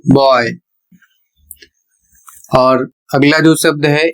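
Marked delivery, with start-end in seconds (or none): 0.0-0.5 put-on बॉय said as "boy", an open O gliding to Y; बॉय as a classroom example word; careful English pronunciation. Shown as a demonstration. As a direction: model बॉय with a clear OY glide. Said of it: "वह होगा".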